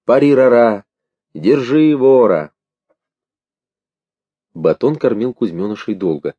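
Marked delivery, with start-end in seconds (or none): 0.0-2.5 high, drawn-out raised chant; raucous mocking sing-out; hooting refrain. A narrator's reading. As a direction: call it slowly, stretching the vowels like a jeering crowd. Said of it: "Под улюлюканье, под насмешки".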